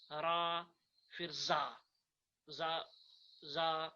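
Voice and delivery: "را" and "زا" with long open aa; Arabic letter names. Shown as a demonstration. Teacher drills letters on screen, Arabic pronunciation. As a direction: repeat "زا" deliberately on a level pitch, holding the aa. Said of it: "ان کے ساتھ ہم الف ملا دیتے".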